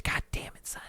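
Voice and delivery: unvoiced whisper of frustration; frustrated whisper. Real, not acted. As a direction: breathe the curse out under your breath. Frustrated over missed chance.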